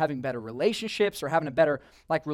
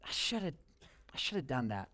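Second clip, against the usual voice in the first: much softer, sinking regret; regretful sigh; 11 dB quieter than usual. Retold or imitated. Person regrets broken resolutions, soft sigh. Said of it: "And you're like".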